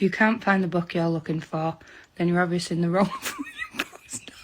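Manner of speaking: whispered aside. Acted. Whispered first take.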